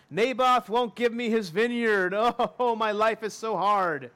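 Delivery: hammed-up, self-pitying whine; mock whining. Sulking king complains to wife about the vineyard, mock whining. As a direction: whine like a sulking child, played for laughs. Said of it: "Ahab whines".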